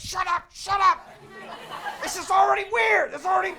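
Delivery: high, loud mock outburst; mortified yelling. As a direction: yell it to silence an embarrassing mom. Panicked retort to mom.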